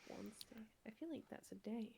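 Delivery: soft unvoiced whisper. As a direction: whisper it like a secret aside. Whispered aside in roleplay.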